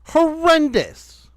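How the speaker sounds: appalled slow emphasis; drawn-out emphasis. Real, not acted. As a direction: stretch "horrendous" slow and high. Listing her faults.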